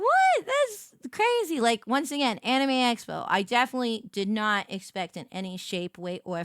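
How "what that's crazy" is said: high, shocked what; high mock disbelief. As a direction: gasp it out in disbelief. Retelling her shock.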